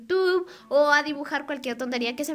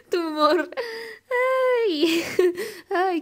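giggling; 5 semitones higher, much slower than usual. Real, not acted. Laughing at the pun again.

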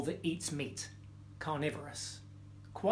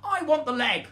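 high, loud demanding cub; shouting cub; 8 semitones higher, 5 dB louder than usual. Acted. First cub demanding the leg from mother, shouting. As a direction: shout it like a greedy youngster. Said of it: "shouted the first cub".

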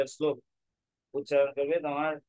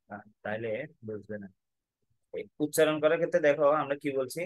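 usual speaking voice

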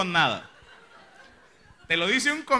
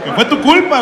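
louder and higher, jabbing blame; 6 semitones higher, 8 dB louder than usual. Acted. At the reckless driver who caused it, a loud scolding. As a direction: throw the blame in his face.

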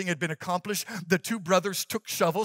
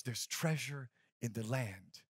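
hushed echo; 7 semitones lower, 11 dB quieter, slower than usual. A narrator's reading. Echo of dying words.

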